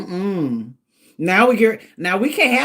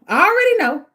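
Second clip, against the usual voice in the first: higher and louder, insisting; emphatic; 10 semitones higher, 5 dB louder, faster than usual. Real, not acted. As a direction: punch it out like you mean it. Emphatic repeat of own words.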